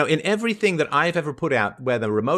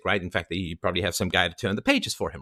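ordinary delivery

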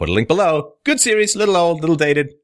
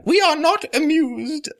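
much higher, slow regal put-on voice; haughty queenly disdain, drawn out high; Queen Victoria. Host mocks disapproval as Queen Victoria, high and slow. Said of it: "the old Queen Victoria line".

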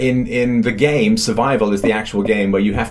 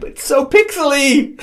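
amused disbelief; 12 semitones higher, slower than usual. Real, not acted. Amazed by pixel graphics.

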